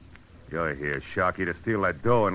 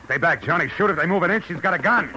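urgent; 11 semitones higher, 4 dB louder than usual. Acted. Tense standoff commands.

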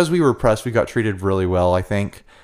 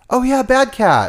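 excited; 3 dB louder than usual. Real, not acted. Excited recall of name.

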